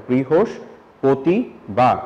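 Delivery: বৃহস্পতিবার said slowly, each syllable apart; scanning speech demo; syllables separated. Shown as a demonstration. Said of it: "আলাদা আলাদা করে বলছে কিনা".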